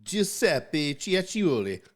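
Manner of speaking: high, slow mock Italian pizza-shop accent; Italian pizza guy. Joking with friends, mock Italian pizza-shop owner accent. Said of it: "pizza shop owner".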